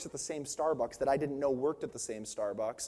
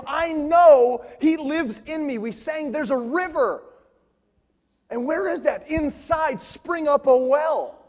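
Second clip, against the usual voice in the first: higher, louder, building preacher's call; preaching at full force; 9 semitones higher, 9 dB louder than usual. Acted. Pastor to congregation, rising into full-force preaching. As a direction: drive it home to the whole room.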